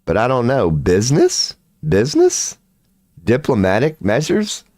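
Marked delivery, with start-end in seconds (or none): 1.9-2.6 much higher, squeaked rising question; sudden high squeaky questioning. Shown as a demonstration. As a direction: squeak the word like a puzzled cartoon. Repeats word, second time squeaky.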